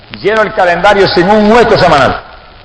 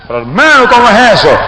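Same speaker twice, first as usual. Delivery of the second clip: higher, indignant retold question; startled protest; 6 semitones higher than usual. Accused preacher answering the evangelist's repeated charge, startled protest. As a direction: protest in raised-pitch disbelief. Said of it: "Yo me asusté y dije".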